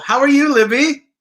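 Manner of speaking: normal talking voice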